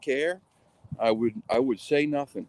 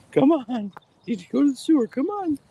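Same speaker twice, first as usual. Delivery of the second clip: pet-coaxing voice; 4 dB louder than usual. Real, not acted. Coaxing a pet toward sewer.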